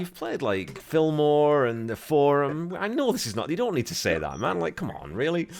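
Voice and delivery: mock exasperation. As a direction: call out the flattery with mock disbelief. Mocking the rock star's claim.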